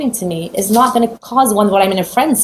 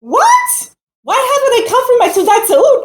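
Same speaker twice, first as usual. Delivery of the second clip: shocked; 13 semitones higher than usual. Retold or imitated. Stunned reaction to the news.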